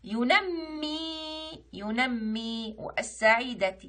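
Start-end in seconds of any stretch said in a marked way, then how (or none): 0.0-2.8 ينمي said slowly, doubled م and long final ee; emphasised shadda. Shown as a demonstration. Teacher pointing out the shadda, ينمي slow and stretched. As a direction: draw out ينمي to show the shadda. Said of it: "كلمة بها شدة".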